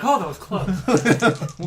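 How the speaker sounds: mock relieved. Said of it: "You can be like".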